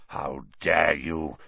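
low, slow menacing growl. A narrator's reading. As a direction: growl it like a huge dog. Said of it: "he growled".

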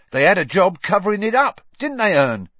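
high, loud, excitable gossip; chatty Stan. Acted. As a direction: relish the scandal, pitched right up.

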